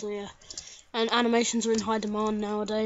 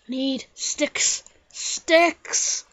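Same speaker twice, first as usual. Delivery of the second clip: need sticks; 3 semitones higher, 6 dB louder, much slower than usual. Pretend. Calling out for sticks.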